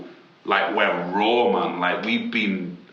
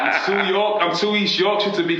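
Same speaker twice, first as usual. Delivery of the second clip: excited; 11 semitones higher, 4 dB louder than usual. Real, not acted. Proud claim after laughing.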